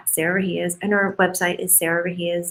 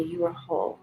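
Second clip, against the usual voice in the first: soft self-affirmation; 8 dB quieter than usual. Shown as a demonstration. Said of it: "almost hear myself telling it to me".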